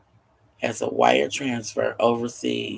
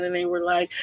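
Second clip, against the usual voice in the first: ordinary delivery, nothing marked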